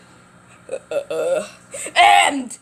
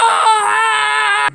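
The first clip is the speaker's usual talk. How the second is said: sudden loud high scream; 10 semitones higher, 10 dB louder than usual. Acted. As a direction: cry out as if hurt. Freddy falls and breaks bone.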